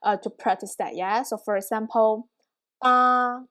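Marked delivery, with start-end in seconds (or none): 2.8-3.4 sustained level pitch on "ba"; first tone. Shown as a demonstration. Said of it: "I always use the same tone".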